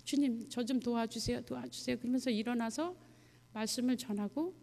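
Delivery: ordinary delivery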